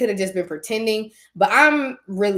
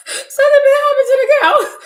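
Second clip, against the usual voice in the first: much higher, loud, laughing through the words; 11 dB louder than usual. Retold or imitated. Retelling the cow line to listeners, laughing. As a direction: crack up while repeating it.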